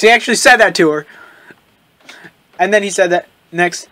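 normal talking voice

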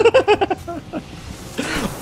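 high laugh. Real, not acted. Laughing at mistake.

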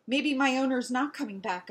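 ordinary delivery

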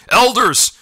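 loud, high urgent call; shouting. A narrator's reading. Captain running in to warn the elders, shouting. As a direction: call out across the clearing. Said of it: "shouted a gray tabby".

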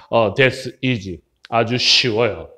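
low, soft, unhurried dialogue voice; low-voiced confident character. Classmate saying the quiz is easy, low calm voice. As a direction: drop pitch and volume, slow the English. Teacher acting the confident second speaker.

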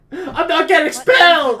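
high, loud shout with a laugh in it; hammed-up yell. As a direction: yell a mock protest, laughing.

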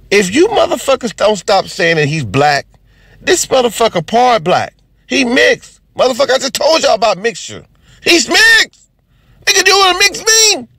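rising exasperated rant, pitch climbing high. Real, not acted. To the audience about Obama, a climbing exasperated rant.

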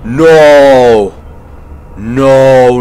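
real panic at the hunters; panicked no. Real, not acted. Hunters appear in horror game.

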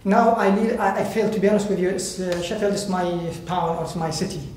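ordinary talk

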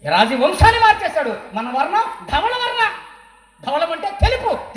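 fiery pulpit declaration; preaching proclamation. Real, not acted. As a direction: hammer home the good news. Preacher proclaims at full force.

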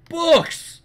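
high, loud delighted cry; thrilled shout at the shelves; excited. Real, not acted. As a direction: gasp it out like finding treasure. Spots a library.

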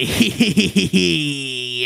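low, soft evil chuckle; long gloating villain snicker; villain laugh. Acted. Mongo after threatening Wudo, low evil laugh.

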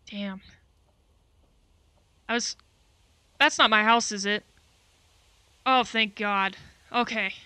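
ordinary talk, nothing marked

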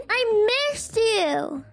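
put-on high, eager little boy. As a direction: play an excited young son. Son voiced greeting sitter.

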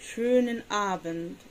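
schönen said very slowly with a long rounded ö; slow demo. Shown as a demonstration. Teacher modelling schönen Abend for learners, slow. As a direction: draw out schönen for the class.

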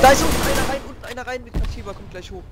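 high, loud warning call; urgent callout. Real, not acted. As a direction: shout the warning fast. Callout of enemy entering below.

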